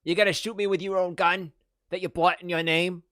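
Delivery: tough-guy film impression, voice off your own; James Gandolfini. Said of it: "pretty good James Gandolfini impression".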